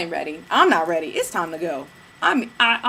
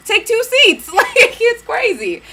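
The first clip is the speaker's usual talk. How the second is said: laughing; 13 semitones higher, 7 dB louder, slower than usual. Real, not acted. Laughs at her own punchline.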